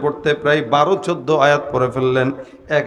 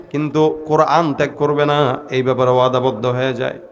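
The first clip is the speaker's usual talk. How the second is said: usual speaking voice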